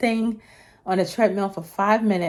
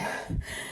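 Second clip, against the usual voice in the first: breathless sigh; 11 dB quieter than usual. Real, not acted. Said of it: "I don't know if you can hear me".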